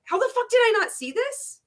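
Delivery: very high-pitched, astonished retold question; incredulous disbelief. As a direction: act out baffled disbelief at yourself. Voices disbelief felt after leaving.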